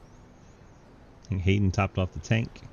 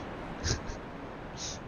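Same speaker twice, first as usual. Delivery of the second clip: stifled giggles; 14 dB quieter than usual. Real, not acted. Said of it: "trying not to laugh".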